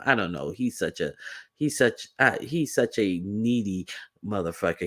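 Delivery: ordinary delivery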